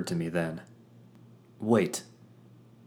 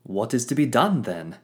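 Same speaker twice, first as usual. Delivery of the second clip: grandiose hero voice; 5 semitones higher, 5 dB louder than usual. Acted. Fitz's grand voice.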